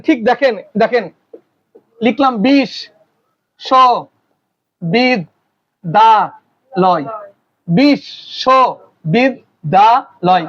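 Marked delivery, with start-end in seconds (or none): 2.5-7.0 "বিষ, স, বিদ, দা, লয়" as five separate beats with long gaps; syllable by syllable. Shown as a demonstration. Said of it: "যতটুকু উচ্চারণ করা হয় তাকে কি বলে". 7.7-10.4 "বিষ, স, বিদ, দা, লয়" as five separated beats; syllable by syllable. Shown as a demonstration. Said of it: "কয়বার উচ্চারণ করছেন".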